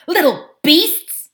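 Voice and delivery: high, loud, slow insult; disgusted schoolboy outburst; indignant boy. A narrator's reading. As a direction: spit it out in outrage.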